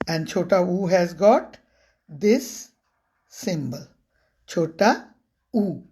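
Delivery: ordinary talk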